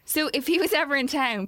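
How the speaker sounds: usual speaking voice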